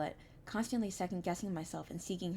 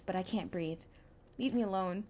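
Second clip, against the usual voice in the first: ordinary talk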